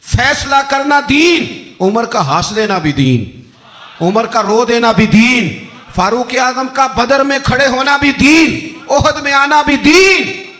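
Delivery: loud, high, rising preacher's cry, slow on the big lines; preaching at full force. Acted. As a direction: build the list to a fervent climax. Preacher's escalating list of praise. (जोशीली तक़रीर, ऊँची और बुलंद आवाज़)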